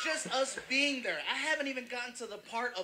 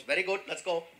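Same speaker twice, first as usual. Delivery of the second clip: put-on Indian accent; 4 semitones lower than usual. Retold Indian cab driver agrees.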